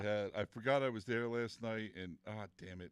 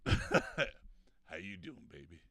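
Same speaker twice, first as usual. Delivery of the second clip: pitched way down through a harmonizer, slow; deep voice effect; 6 semitones lower, much slower than usual. Pretend. Host testing a broken voice effect, deep harmonized croon. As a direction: drop into a deep robotic crooner voice. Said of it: "sounds like you're three octaves lower".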